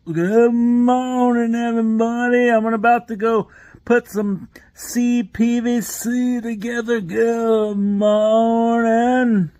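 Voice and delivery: high, slow, stretched-out good morning; John Phillips Plumbing impression. Plumber vlogger parody greeting everybody, high and slow. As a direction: drag the greeting out, cheery and loud. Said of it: "John Phillips Plumbing".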